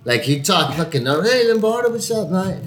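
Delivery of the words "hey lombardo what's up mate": high-pitched imitated Irish greeting; Irish accent. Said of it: "I used to know this Irish guy".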